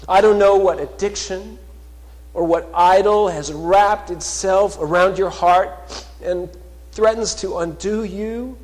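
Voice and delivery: loud, high, rising sermon voice, slowing on key words; fervent pulpit proclamation; full-force preaching. Acted. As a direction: preach it out to the whole church. Preaching appeal about struggles.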